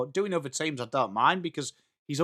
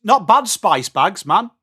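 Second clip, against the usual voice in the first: ordinary talk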